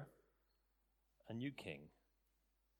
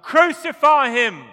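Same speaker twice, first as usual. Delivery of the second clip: full-throated roar, much higher and louder; crowd shout; 27 dB louder, slower than usual. Retold or imitated. Preacher mimics the crowd before Pilate, full shout. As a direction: bellow it like the mob demanding death. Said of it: "They'd shouted".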